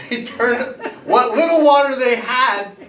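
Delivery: laughing through the words, rising high; cracking up at the absurdity. Real, not acted. Teacher to class, laughing at the magicians' blunder.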